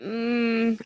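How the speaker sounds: ordinary delivery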